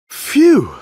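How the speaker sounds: high, loud gasp of relief; relieved phew. Acted. Sully after hiding the girl from Randall, a high relieved phew. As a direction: blow out the breath like a narrow escape.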